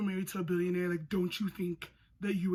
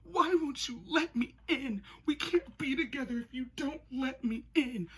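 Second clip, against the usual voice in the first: put-on high whiny girlfriend; 6 semitones higher, 3 dB louder than usual. She goes, quoting Anastasia.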